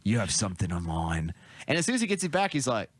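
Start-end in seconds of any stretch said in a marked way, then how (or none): 0.0-1.3 low New Zealand accent impression; lower, rough Kiwi accent; Boba Fett New Zealand accent. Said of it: "it's a New Zealand accent".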